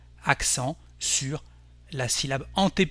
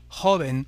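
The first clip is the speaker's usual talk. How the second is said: joven stressed on JO; Spanish joven, accent on "jo"; stress on second-to-last syllable; 4 dB louder than usual. Shown as a demonstration. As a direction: say JO-ven with the weight up front. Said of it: "l'avant-dernière syllabe et on dira".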